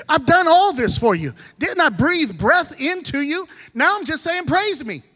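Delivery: God reminding his people what he gave them; God speaking. Retold or imitated. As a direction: preach it as God's own appeal. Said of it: "he says".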